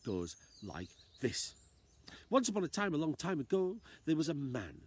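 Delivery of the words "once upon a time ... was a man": high, lively fairy-tale narration; storyteller opening. A narrator's reading. Storyteller opens a tale for children in Storyland, lively.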